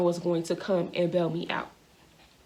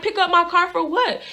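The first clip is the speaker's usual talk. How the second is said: high-pitched retold disbelief; incredulous question; 10 semitones higher, 3 dB louder than usual. Driver asked who can get her car, incredulous. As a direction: query it high, baffled.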